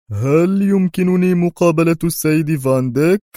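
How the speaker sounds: a fake foreigner's polite request; broken English. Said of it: "بإنجليزية ركيكة".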